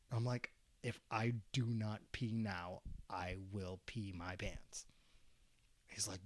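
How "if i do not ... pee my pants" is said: deliberate word by word, sinking to a whisper; slow, measured warning. Retold or imitated. Student warning his teacher he must pee, slow and deliberate. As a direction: spell it out slowly, trailing into breath.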